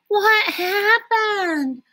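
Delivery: high, slow, startled question; surprised. A narrator's reading. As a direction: gasp out the question in shock. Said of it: "Alexander asked, surprised".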